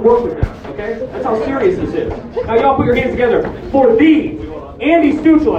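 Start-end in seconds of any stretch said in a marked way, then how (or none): 2.4-5.6 hyped-up host intro; grand entrance announcement. Real, not acted. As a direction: build the name up for the crowd. Host introducing comic to stage.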